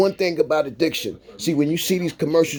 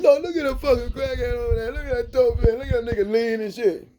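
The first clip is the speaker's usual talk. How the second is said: higher, fast, sneering voice of street gossips; mocking onlookers; 8 semitones higher, faster than usual. Mimicking people who mock addicts, higher and fast sneering. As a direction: ape the people pointing and laughing at addicts.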